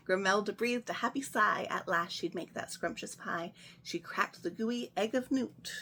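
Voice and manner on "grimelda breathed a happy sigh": ordinary delivery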